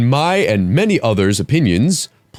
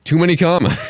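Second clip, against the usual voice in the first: exasperated shout; 8 semitones higher than usual. Real, not acted. Exasperated outburst at commas.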